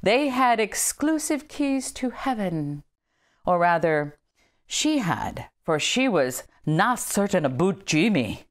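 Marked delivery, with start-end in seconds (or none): 0.0-5.5 put-on a light old Scotswoman's Scottish accent, pitched higher and slower. Reading about an old Scotch body, light Scottish accent. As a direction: ease into a Scottish lilt for the old kirk woman. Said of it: "Forgive my accent coming up".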